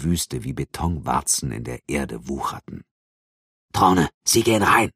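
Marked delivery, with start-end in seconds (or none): 3.7-4.9 order. Acted. Lieutenant orders entry.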